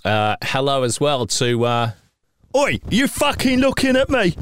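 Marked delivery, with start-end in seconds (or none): 2.6-4.3 mock-tough Aussie. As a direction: ham up a pub brawler picking a fight. Mock aggressive Aussie lout.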